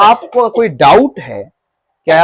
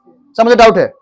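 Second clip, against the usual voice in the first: loud, higher blurt at the teacher; shouting out; 4 semitones higher, 6 dB louder than usual. Shown as a demonstration. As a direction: call it out rudely, all in a rush. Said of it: "ऐसे चिल्ला के नहीं बोलोगे". (चिल्लाकर बोलें)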